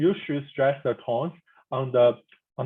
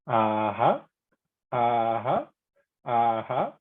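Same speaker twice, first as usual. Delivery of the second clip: "aha" dipping low, then climbing; deep tone; 3 semitones lower, much slower than usual. Shown as a demonstration. Teacher giving a familiar model for the deep tone. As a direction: sink on "aha", then lift it. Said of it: "This is a deep tone".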